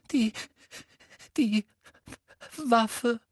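put-on high, halting girl's voice; faltering confession. A narrator's reading. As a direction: stammer it out reluctantly, very high. Hermine's feigned tearful confession.

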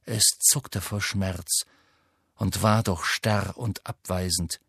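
ordinary delivery

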